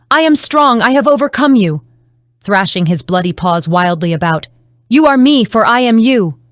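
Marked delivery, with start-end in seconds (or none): 0.1-1.8 loud, high proclaiming cry; triumphant scream. A narrator's reading. Akuma proclaiming her strength to the beast, loud and high. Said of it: "screamed Akuma". 4.9-6.4 loud, higher frenzied yell; bellowing. A narrator's reading. Beast thrashing bloody paws at Akuma, bellowing loud.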